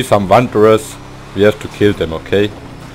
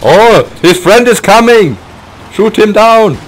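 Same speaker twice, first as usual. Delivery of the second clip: high, loud excited callout; raging gamer; 11 semitones higher, 4 dB louder than usual. Real, not acted. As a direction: yell the order mid-fight. Excited dogfight in game.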